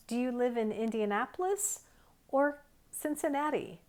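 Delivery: teacher modeling rising then falling tune around "or"; choice-question intonation. Shown as a demonstration. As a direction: voice rises on everything before "or", then falls after it. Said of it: "everything before or has a rising intonation, and everything after it has a falling intonation".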